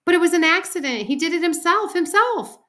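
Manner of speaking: high, loud frantic protest; shrieky defensive outburst. A narrator's reading. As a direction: wail your excuse as a panicky girl. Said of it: "Sheila cried louder".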